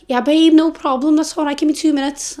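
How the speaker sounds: high, sing-out friendly server voice; baguette seller. Retold or imitated. Baguette seller taking a regular's order, high-voiced. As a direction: pitch it way up as the server.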